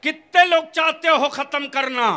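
high, loud call to the whole crowd; rallying shout. Real, not acted. Preacher polling the crowd on ending dowry, rallying shout. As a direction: shout the question out for a show of support.

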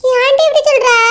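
usual speaking voice

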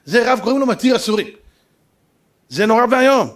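ordinary talk, nothing marked

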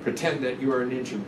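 ordinary delivery

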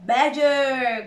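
loud, drawn-out call of recognition; delighted cry. A narrator's reading. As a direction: call out to an old friend. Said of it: "cried Mister Fox".

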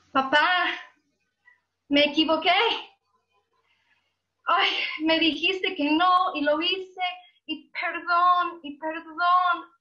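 loud, high tearful confession; acted sobbing, begging papá's forgiveness; crying plea. Worshipper crying to God as papá at customer service, pleading. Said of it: "llorando, diciendo".

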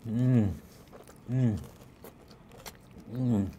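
humming while chewing; muffled mid-bite murmur; mouth full of food. Real, not acted. Seen 0: both people bite toast mid-hum; speaker unclear; bruschetta not pizza.

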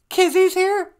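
very high, loud puppet-like voice; high-pitched comic sidekick; squeaky sidekick. Pretend. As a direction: pipe up far above your own voice.

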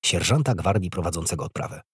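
ordinary delivery

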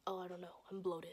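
soft, near-whispered dodge; hushed, imitated brush-off; evasive excuse. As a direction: mumble the excuse quietly. Dodging dad's question.

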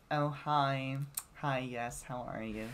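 lightly put-on girly greeting; mildly mock a polite, affected hello; that type of girl. Imitating a classmate's type, lightly voiced greeting. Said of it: "like those types of girls that".

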